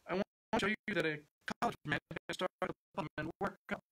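quick, high eager pitch; young job seeker eager to please, fast and high. A narrator's reading. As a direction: rush it out to impress the boss. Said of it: "he said eagerly".

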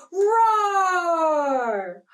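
put-on high playful roar for the children; lion roar. Shown as a demonstration. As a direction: let out a cartoon lion roar. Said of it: "Can you roar like a lion too?".

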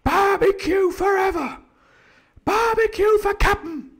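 very high, drawn-out pirate cheer; crew crying out. A narrator's reading. Pirates cheer Long John by his nickname, crying out. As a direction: chant it like a delirious mob. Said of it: "they cried".

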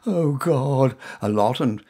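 ordinary delivery

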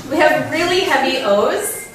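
ordinary delivery

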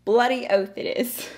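lightly Australian Bloody oath it is; mock Aussie reply. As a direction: play an Aussie agreeing. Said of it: "an expression of agreement".

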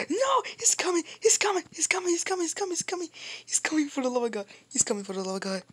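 high, loud panicked repeating. Real, not acted. Panicking as the banana comes.

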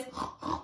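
short unvoiced hippo snorts. Shown as a demonstration. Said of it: "Snorting like a hippopotamus?".

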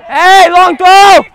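loud, slow drawn-out call; long call. Real, not acted. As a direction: stretch the shout across the field. Calling out across the pitch.

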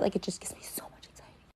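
a hushed whisper, almost no voice; whispered anxious aside. Real, not acted. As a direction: breathe it out like a confession. Overwhelmed, confessing anxiety.

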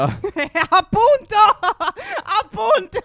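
laughing through the words. Real, not acted. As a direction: protest while cracking up. Laughing while protesting.

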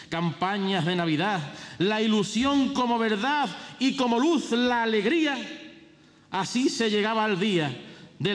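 high, ringing proclamation of verse; pregón herald. Acted. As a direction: declaim it like a festival herald.

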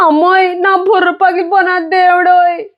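high, sobbing wail; crying wail. Acted. Bleeding boy wailing that his head is broken, sobbing high. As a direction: cry out to mother and God, weeping. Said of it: "ఎర్రప్పడి ఏడుపు".

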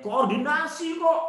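high, mock-innocent protest; making excuses. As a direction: imitate a feeble excuse, pitch rising.

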